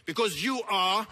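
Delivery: loud, high, urgent rebuke; impassioned preaching. Real, not acted. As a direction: drive the accusation home at full force. Impassioned rebuke at full force.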